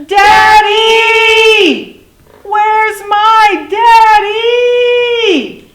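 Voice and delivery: high, drawn-out storybook call for a parent; yelling. A narrator's reading. Lost little dinosaur yells for his daddy, long high holler. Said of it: "He yelled".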